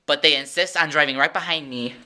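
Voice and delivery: ordinary talk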